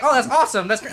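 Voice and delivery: polite. Shown as a demonstration. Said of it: "I'm gonna be very polite".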